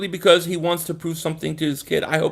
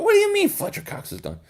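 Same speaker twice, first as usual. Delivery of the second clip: incredulous question; 13 semitones higher than usual. Real, not acted. Disbelief at a viewer's claim.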